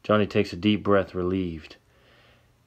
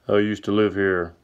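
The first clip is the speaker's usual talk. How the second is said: deep voice. A narrator's reading. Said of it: "says Sam with his deep voice".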